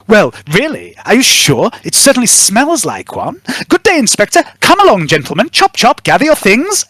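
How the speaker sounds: high, sneering noblewoman; imperious lady dismissing the inspector; haughty Lady Roth. Acted. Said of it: "Lady Roth".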